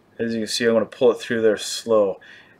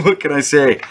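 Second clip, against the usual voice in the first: said through a real laugh, louder; 3 semitones higher, 5 dB louder than usual. Real, not acted. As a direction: laugh it out, amused at himself.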